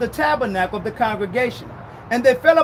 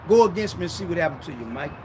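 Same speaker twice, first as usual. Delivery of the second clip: low threat; 7 semitones lower, 3 dB quieter, faster than usual. Acted. God warning, low fast.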